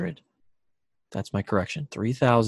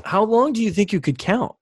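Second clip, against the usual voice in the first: raised voice against the wind; shouting over wind; 8 semitones higher than usual. Real, not acted. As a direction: call it out over gusts. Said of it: "The wind is so strong".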